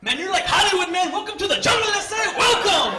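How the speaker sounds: hammed-up gang guy yelling welcome. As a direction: bellow it like a jail greeter.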